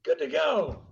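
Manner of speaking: mock-cheery military send-off, pitched high; mock upbeat announcer of orders. Voices those giving him deployment orders.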